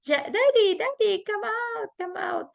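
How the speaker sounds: high imitated toddler calling daddy; child calling daddy. Mom imitating her son calling daddy from the bathroom, high. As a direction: call for daddy like an impatient little boy. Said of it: "So he said".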